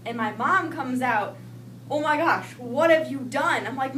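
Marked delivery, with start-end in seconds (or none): 1.9-3.7 loud, raised-pitch shock; alarmed mother. Retold or imitated. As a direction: imitate a horrified mom demanding answers. Mom sees her injured child.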